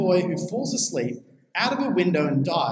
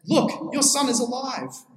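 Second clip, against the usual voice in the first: high, slow announcement of good news; mild proclamation; 7 semitones higher than usual. Retold or imitated. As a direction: announce it slowly, pitch lifted high. Reading Elijah announcing the revived son.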